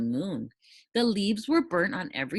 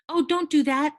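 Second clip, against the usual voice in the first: much higher, louder panicked plea; alarmed cry; 12 semitones higher, 6 dB louder, faster than usual. Acted. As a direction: beg him not to, alarmed. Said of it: "the dragon cried".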